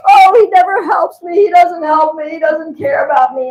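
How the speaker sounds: imitating a weepy, shouting outburst; tearful wail. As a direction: wail it through tears like the wronged wife. Wife complains tearfully about husband.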